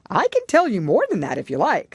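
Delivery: loud, eager Gryphon voice. A narrator's reading.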